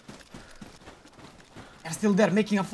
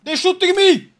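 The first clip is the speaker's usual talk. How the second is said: alarmed shout; 7 semitones higher, 5 dB louder than usual. Pretend. Under fire, alarmed.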